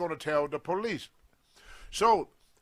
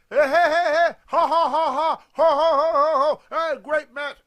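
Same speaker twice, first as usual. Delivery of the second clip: much higher and louder, cackling hey-hey-ha-ha; mocking jeer; 9 semitones higher, 11 dB louder than usual. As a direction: imitate a gloating crowd laughing him down. Wrestlers jeering in the dressing room.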